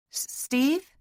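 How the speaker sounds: high, tentative, stuttered question; nervous, slightly breathless asking; a little breathless. A narrator's reading. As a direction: catch on the first sound, then rise hopefully. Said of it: "she asked a little breathlessly".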